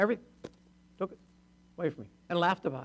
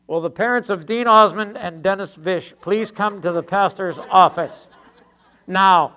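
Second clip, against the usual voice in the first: loud, slow public announcement; drawn-out announcer call, landing hard on the last word; 3 semitones higher, 11 dB louder, slower than usual. Acted. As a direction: summon parents over the church PA, loudly. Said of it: "one of the announcements you would have heard continuously".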